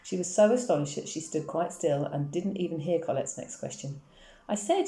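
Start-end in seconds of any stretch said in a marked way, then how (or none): 4.5-4.9 put-on very high, insistent child's call; mild shout pitched right up. A narrator's reading. Schoolgirl repeating her question to a friend, shouted. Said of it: "shouted Colette".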